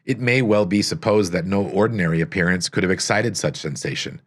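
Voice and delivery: ordinary delivery, nothing marked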